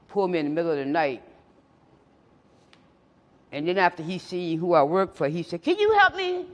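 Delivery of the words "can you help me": much higher, louder pleading cop voice. Retold or imitated. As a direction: beg like the cop did. Cop asks narrator for help.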